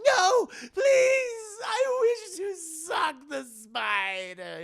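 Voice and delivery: high, loud wailing madman, slow; crazed pleading. Retold or imitated. Renfield begs the orderly to let him eat spiders, wailing. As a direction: beg like a raving madman.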